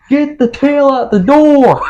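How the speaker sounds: high panicked yell, slowed; frantic shout. Retold or imitated. Brother yelling to free the dog's tail, high panicked shout. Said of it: "He's like".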